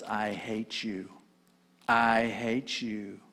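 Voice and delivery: low, soft, slow hateful voice; Smeagol hating. Acted. Smeagol answering Gollum, low and slow. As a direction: drop low and quiet, full of hate.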